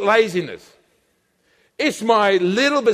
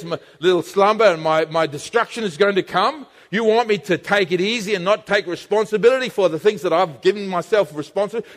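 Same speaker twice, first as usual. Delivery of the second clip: ordinary talk, nothing marked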